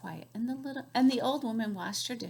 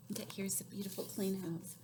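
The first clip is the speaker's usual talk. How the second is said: whisper; 8 dB quieter than usual. Real, not acted. Aside to child, unvoiced.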